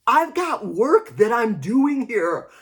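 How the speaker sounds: imitating the husband, a bit irritated; mock annoyed husband. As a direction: play the busy husband brushing her off. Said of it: "he was like".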